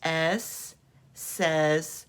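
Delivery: very slow, hissing the final S; slow sentence drill. Shown as a demonstration. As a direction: say S says S slowly for kids to echo. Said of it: "Now make the sentence".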